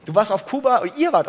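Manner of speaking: calling across to a distracted listener. Real, not acted. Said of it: "Robert ist in der Bibel vertieft".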